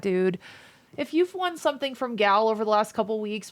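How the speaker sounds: normal talking voice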